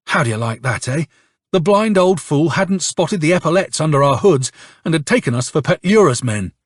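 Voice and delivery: ordinary delivery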